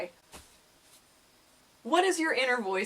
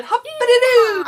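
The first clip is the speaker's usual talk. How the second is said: Tigger; 14 semitones higher, 14 dB louder than usual. Acted. Asking if viewers feel bouncy, high whooping cartoon voice. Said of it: "Or are you Tigger?".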